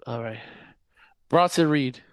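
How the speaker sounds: normal talking voice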